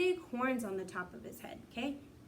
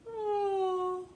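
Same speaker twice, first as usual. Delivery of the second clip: tired oh; 9 semitones higher, 6 dB louder than usual. Acted. Acting out being tired.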